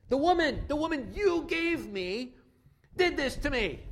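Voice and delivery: blaming. Acted. Adam blaming God and Eve.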